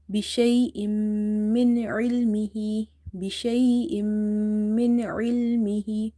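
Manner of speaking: بشيء من with a long nasal hum on the joined m; idgham with ghunna. Shown as a demonstration. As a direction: merge into the mim of من and hold the hum. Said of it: "you will do idgham with a gunna".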